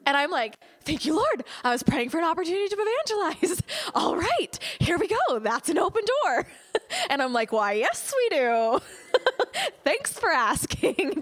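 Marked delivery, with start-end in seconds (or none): 0.8-6.5 higher and louder, laughing with delight; laughing, overjoyed answer to a prayer; thrilled thanks. Retold or imitated. Retelling her thrilled inner reply. 7.5-11.2 laughing, overly polite answer; faux-nonchalant, laughing; mock casual. Mock-casual reply to the hitchhiker.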